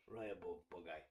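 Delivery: Raya Bove bug-eye said low and soft in a mock Russian accent; playful deep Russian accent. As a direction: drop low and put on a Russian accent for the name. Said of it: "You have to say it with a deep Russian accent".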